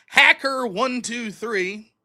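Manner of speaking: each number slowly and deliberately: "one two three"; slow spelled out. Retold or imitated. Reveals the scammer's silly password.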